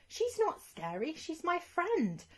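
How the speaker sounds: high, slow pleading voice; earnest defence. Acted. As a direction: plead for the friend sincerely. Defending dragon friend.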